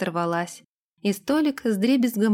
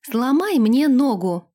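blurted out; 3 semitones higher, 5 dB louder than usual. Acted. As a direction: blurt it louder and slower. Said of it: "выпалила Лилейна". (выпалить громко)

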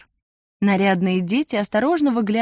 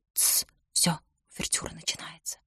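hushed whisper, shushing; old woman whispering; 3 semitones lower, 11 dB quieter than usual. A narrator's reading. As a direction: whisper so nobody hears. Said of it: "Ещё тише". (шёпот пожилой женщины)

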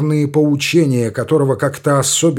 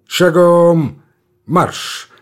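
drill command; 4 semitones higher, 3 dB louder, much slower than usual. A narrator's reading. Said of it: "приказывает Тучапский".

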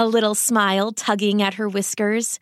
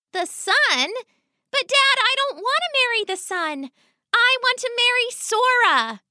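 put-on much higher girl's voice, dismayed; pleading young rat girl; daughter rat protesting.

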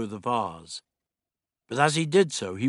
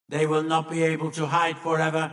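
higher alien monotone; monoid voice; 5 semitones higher, 4 dB louder than usual. A narrator's reading. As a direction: declare it relentlessly on a single note. Monoid threatening the hidden Refusians.

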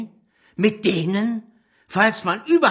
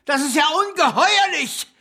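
outraged disbelief; 7 semitones higher than usual. Acted. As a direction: spit out the outrage at a ceasefire. Outraged at offering ceasefire.

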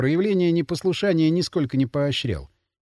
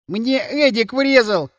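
high, slow, mildly wailed complaint; tearful wail; 6 semitones higher, 3 dB louder, slower than usual. A narrator's reading. Boy complains to Гера about being hit, tearfully wailing. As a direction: wail it, holding back tears. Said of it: "завопил он, едва сдерживая слёзы".

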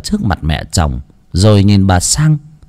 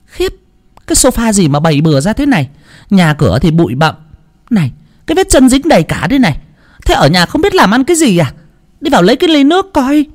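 high, fast, scornful nagging; contemptuous nagging; 10 semitones higher, faster than usual. Acted. Mother-in-law nagging her daughter-in-law, high and scornful. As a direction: pick at every flaw with disdain. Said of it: "nguýt dài một hơi rồi nhìn quanh mà bảo".

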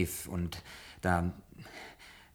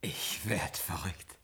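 stunned whisper; 4 semitones lower, 11 dB quieter, much slower than usual. Acted. Stunned at gift of model ship.

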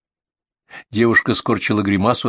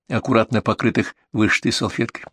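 usual speaking voice

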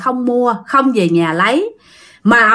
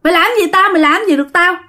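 higher-pitched, cocky retold defiance; insolent; 7 semitones higher, much faster than usual. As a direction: throw it out as an insolent dare. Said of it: "mà ổng nói bố láo lắm".

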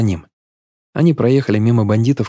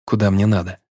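ordinary delivery

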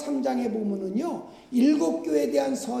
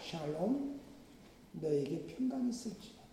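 softer, hushed voice of Jesus's blessing; soft tender blessing; 7 semitones lower, 14 dB quieter than usual. Acted. Preacher voicing Jesus greeting frightened Peter, softly and tenderly. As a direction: speak peace gently, almost hushed.

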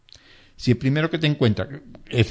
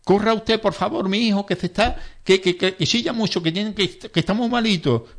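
pleading, much higher voice, tumbling over the words; panicked relative; 7 semitones higher than usual. Retold or imitated. Relative begging the first-aider to tend her son, panicky high pleading. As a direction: beg the rescuer frantically for your son. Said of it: "la presión de los familiares de la víctima".

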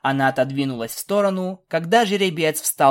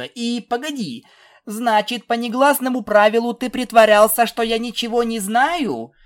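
high, heated filly voice getting louder; indignant; 9 semitones higher, 5 dB louder than usual. A narrator's reading. Filly calls out the princess's silly pretence, indignant. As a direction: argue back, building in pitch and volume.

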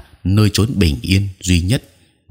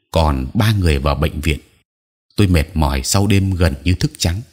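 usual speaking voice

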